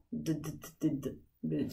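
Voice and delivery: d, d, d as short low bursts, not the letter name; repeated letter sound. Shown as a demonstration. Teacher asks what children hear, repeating d in short bursts. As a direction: tap out the bare d sound over and over. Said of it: "qu'est-ce que j'entends".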